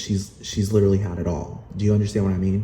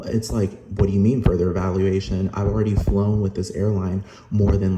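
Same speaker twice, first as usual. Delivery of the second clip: usual speaking voice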